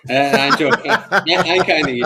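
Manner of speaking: joking, breaking up with laughter; laughing. Real, not acted. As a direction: laugh while owning up to teasing. Teasing the guest about his accent.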